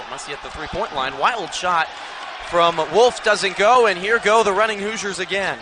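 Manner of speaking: usual speaking voice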